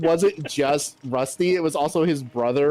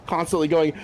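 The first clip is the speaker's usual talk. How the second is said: ordinary delivery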